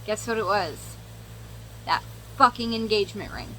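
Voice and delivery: normal talking voice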